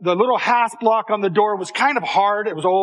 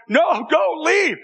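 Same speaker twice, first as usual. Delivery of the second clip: panicked plea; 7 semitones higher, slower than usual. Retold or imitated. Boy begs uncle not leave.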